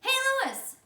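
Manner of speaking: high, loud call across the field; loud calling shout. Acted. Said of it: "Damien shouted".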